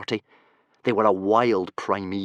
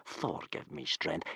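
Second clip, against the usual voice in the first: exasperated whispered sigh to Thor; whispered aside; 3 semitones higher, 13 dB quieter, slower than usual. Acted. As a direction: breathe it out under your breath, fed up.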